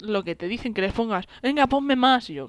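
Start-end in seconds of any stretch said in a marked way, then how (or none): 1.4-2.3 put-on drunk customer. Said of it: "Lo típico de los borrachos".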